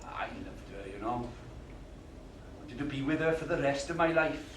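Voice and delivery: soft, sincere; tender confession. Acted. Man confessing love for Gloria, soft tender confession. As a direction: confess it gently.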